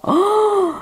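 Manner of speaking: high exclaimed oh of delight. Real, not acted. Reader exclaiming with joy at the cards. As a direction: gasp out an oh of pleasure.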